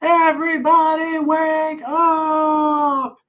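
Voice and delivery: long, high wake-up call held on every syllable; high drawn-out shout. Acted. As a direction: shout it slowly to wake the whole house. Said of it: "and yelled".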